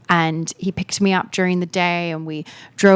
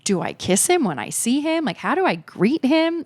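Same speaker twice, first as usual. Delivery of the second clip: rising higher and higher with mock nerves; flustered; 7 semitones higher than usual. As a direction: play the giddy panic of a first greeting. Retold flustered thoughts before meeting.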